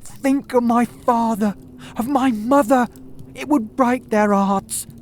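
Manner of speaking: a cornered thief pleading with the detective; panicked Ryder pleading. A narrator's reading. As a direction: beg for your life, voice cracking up high. Ryder begging Holmes.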